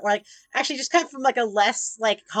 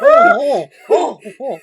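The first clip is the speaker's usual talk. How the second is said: laughing mock oh; 4 semitones higher, 11 dB louder than usual. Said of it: "French people are like Pokemon".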